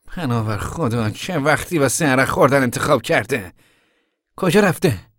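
exasperated. Acted. Tom hears his uncle went drinking, exasperated.

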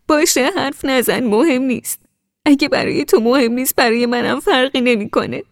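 hurt, choking out the words; tearful. A narrator's reading. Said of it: "گریه کنان گفتم".

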